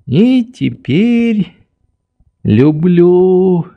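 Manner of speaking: put-on high, slow, stretched old man's voice; obliging old doctor. A narrator's reading. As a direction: answer placidly, drawing out each word. Old doctor answers Nelly.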